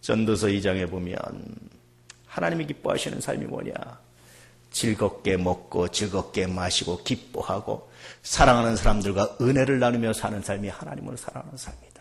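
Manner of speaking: ordinary delivery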